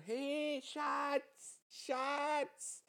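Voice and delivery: calling out. Retold or imitated. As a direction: call across the crowd. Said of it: "schreit eine von hinten". (laut rufend über die Menge)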